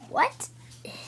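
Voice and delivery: high, hushed whisper of disbelief; amazed whisper. Pretend. Toy stepping out of the time machine, in an amazed whisper. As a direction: breathe it out in astonishment.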